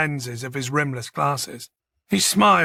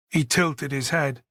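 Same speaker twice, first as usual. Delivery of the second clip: ordinary delivery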